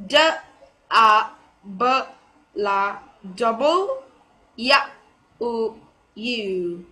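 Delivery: "double U" broken into separate sounds with pauses; slow piece-by-piece demo of "double U"; phonetic sounding-out. Shown as a demonstration. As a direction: sound out D, A, B, La, then join "double". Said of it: "the final letter is".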